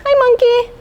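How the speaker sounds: very high little monkey voice; squeaky monkey. Acted. Teacher voicing a monkey greeting the children. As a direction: chirp it like a cheeky monkey.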